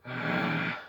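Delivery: long tired sigh; weary sigh. Shown as a demonstration. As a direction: sigh like you're done. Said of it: "The first one is the sigh technique".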